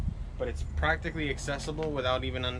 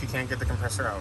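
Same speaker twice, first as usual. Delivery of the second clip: normal talking voice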